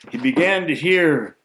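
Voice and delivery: ordinary delivery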